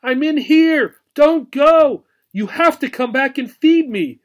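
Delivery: high, loud pleading call; desperate calling. A narrator's reading. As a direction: shout so he hears you. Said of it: "she called out".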